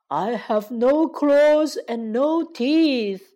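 slow, plaintive, drawn-out lion voice; pitiful lion. Acted. Toothless lion appealing to the girl, pitiful and slow. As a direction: plead pitifully, stretching the words. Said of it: "可怜巴巴地说".

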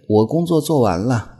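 ordinary talk, nothing marked

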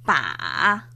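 long PA dipping very low, then rising; third tone. Shown as a demonstration. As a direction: sink PA down low and bring it back up. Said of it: "con los tonos".

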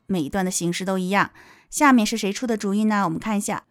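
normal talking voice

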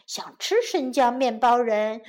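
usual speaking voice

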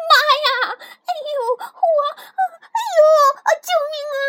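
very high, loud cries of terror; panicked scream. Acted. Girl overrun by frogs from her mouth, screaming in panic. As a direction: wail and yelp for help. More frogs, she calls for help.

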